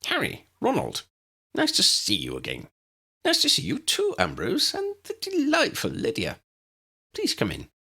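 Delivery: high, squeaky little professor; chirpy, tiny old wizard; Flitwick's voice. Acted. The tiny professor greets them.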